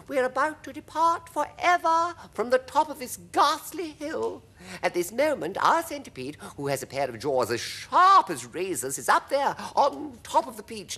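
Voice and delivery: very high, animated ladybird; high-pitched, emphatic creature narrator. A narrator's reading. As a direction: announce it excitedly in a high voice. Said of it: "she said".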